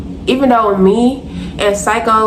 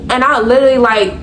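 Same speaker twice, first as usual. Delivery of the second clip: ordinary delivery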